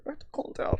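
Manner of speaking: breathless whispered panic, voiced for a scared stranger; panicked whisper. Mimicking a panicking VR Chat user, in a frantic whisper. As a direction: whisper it like someone losing it. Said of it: "He's like".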